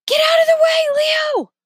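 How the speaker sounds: high, loud warning shout. A narrator's reading. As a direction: yell the warning with everything. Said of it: "Remy shouted".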